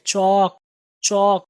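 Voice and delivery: चौक said with a clear au vowel, not चाक; चौक, distinctly with au; careful pronunciation. Shown as a demonstration. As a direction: model चौक slowly for the class. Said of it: "इसको आप चाक नहीं पढ़ेंगे".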